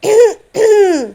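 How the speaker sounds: a sharp, loud throat clear to announce himself. A narrator's reading. Shirish clears throat loudly behind them, pointed throat clearing. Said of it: "घसा साफ करत तो खाकरला".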